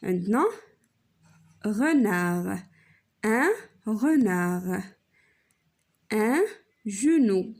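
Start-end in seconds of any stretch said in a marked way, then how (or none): 1.6-7.5 slowly drawn out word; slow teacher demo. Shown as a demonstration. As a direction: stretch it out so the children hear each sound. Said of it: "نشوفوا لو سون كي سو ريبيت". (نطق بطيء وممدود)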